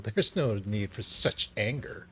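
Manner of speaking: ordinary delivery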